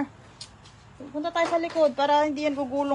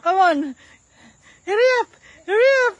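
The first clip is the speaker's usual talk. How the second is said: high, bright calls to hurry; excited cheering; 7 semitones higher, 4 dB louder, faster than usual. Real, not acted. Cheering toddler on. (masiglang pagmamadali sa bata)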